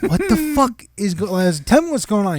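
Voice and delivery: high, loud mock exasperation; exasperated demand. Boss to employee hiding on phone, exasperated demand.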